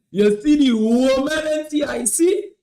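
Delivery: high, loud, drawn-out tease; hyped-up exclamation. Real, not acted. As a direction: stretch it out loud for the fellas. Repeats the hype after laughing.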